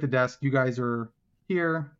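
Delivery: usual speaking voice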